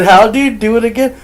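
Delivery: loud, high retold questioning; incredulous question. Retells asking nephew to repeat whisper.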